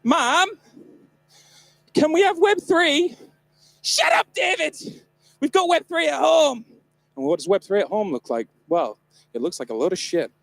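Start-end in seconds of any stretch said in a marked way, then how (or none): 0.0-3.2 put-on high, slow, whiny little kid pleading; begging child. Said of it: "a dramatic reenactment of what it's like when I drive home with my mum as a child". 3.8-6.6 high, loud mock-mum snap; mum shouting back. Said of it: "a dramatic reenactment of what it's like when I drive home with my mum as a child".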